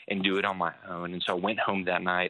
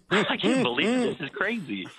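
high-pitched, hammed-up disbelief; incredulous outburst; 12 semitones higher, 4 dB louder than usual. Newcomer recalling a frank sermon, mock incredulous. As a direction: let the mock shock leap way up high. Recalls shock at stage language.

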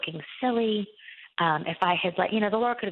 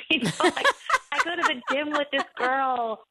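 laughing through the line, pitched up; 9 semitones higher than usual. Real, not acted. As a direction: giggle while saying it. Laughing at her own awkward story.

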